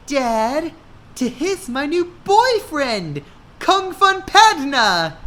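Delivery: put-on high girl voice; proud girl. A narrator's reading. Hannah introducing her boyfriend to her dad.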